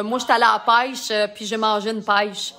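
lightly imitated Shawinigan accent, "pêche" as "paiche"; Québécois accent. Imitating locals who talk like that, light Québécois accent. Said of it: "je connais bien du monde qui parle de même".

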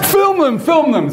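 high, eager command; eager order. Retold or imitated. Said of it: "And I said".

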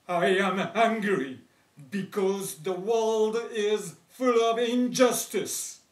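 loud indignant outburst; angry. Acted. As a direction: shout it, fed up with injustice. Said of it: "would say".

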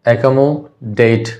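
Accommodate drawn out slowly, with the last syllable DATE set apart. Shown as a demonstration. Teacher dividing a word for students, syllable by syllable. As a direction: say accommodate slowly in parts, ekomo, then date. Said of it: "चार syllable का हो गया word".

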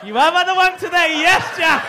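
loud, high teasing call-out; triumphant laughing accusation; mock accusing shout. Real, not acted. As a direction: shout it out so the whole room hears. Teasing audience member Jack loudly.

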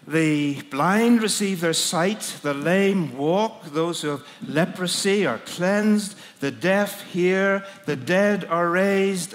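slow, deliberate listing of each phrase; measured recitation. Retold or imitated. Reciting Jesus' words slowly.

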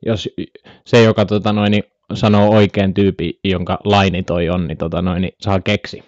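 ordinary delivery

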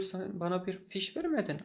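ordinary talk, nothing marked

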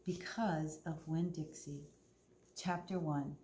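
ordinary delivery

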